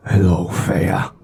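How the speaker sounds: slow, low, otherworldly greeting; creepy fish creature. Acted. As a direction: greet her like a hungry deep-sea thing. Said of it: "looks at you and just goes".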